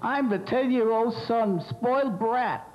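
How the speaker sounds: put-on high, slow bratty boy voice; whiny ten-year-old boy; spoiled brat. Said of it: "cast of characters who will introduce themselves".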